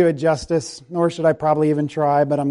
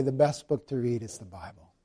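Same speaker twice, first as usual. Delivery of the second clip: softly spoken, much softer; quiet sincere line; 5 semitones lower, 11 dB quieter than usual. Shown as a demonstration. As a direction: say it quietly as a personal confession. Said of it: "Could you raise up the Bible and say".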